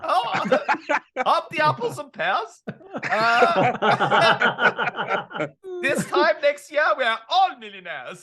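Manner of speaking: high, laughing mock Cockney wide boy; cheeky Cockney impression, breaking into laughs; Del Boy. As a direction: ham up a London market trader, giggling. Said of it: "That was Del Boy".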